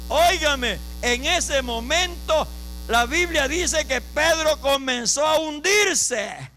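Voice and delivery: slow, stretched words swelling toward a shout; preaching building in force. Real, not acted. Preacher telling brothers of Peter sinking, building preaching. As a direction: build the drama as Peter sinks.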